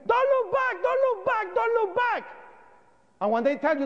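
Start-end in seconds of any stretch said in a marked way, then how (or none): 0.1-2.2 very high, yelled warning from far off; retold police screaming. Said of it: "they start screaming".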